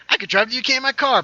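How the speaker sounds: high, fast and loud joking reply; excited burst. Real, not acted. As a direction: blurt it out gleefully, fast. Excited joking answer to chat.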